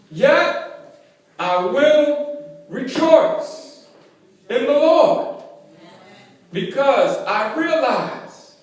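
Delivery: loud, high proclamation easing down in pitch; triumphant preacher's cry; full-force preaching. Real, not acted. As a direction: proclaim it from the pulpit, drawing out key words. Sermon climax.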